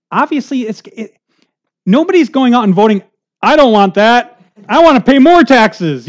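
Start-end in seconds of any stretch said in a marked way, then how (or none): 3.4-6.1 higher, louder mock voter, sarcastic; a sarcastic voter voice, pitched up and louder. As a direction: play the voter who begs for more taxes. Mock voter voicing his wish.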